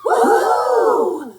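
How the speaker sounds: high, loud whoop; hooting cheer. Acted. Circus crowd hoots as the cat catches the trapeze. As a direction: hoot like a thrilled crowd. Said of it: "hooted the crowd".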